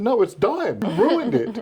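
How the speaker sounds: high, loud scolding; mock scolding. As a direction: tell him off, half joking. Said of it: "I said".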